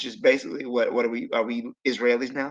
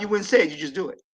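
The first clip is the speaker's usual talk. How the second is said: usual speaking voice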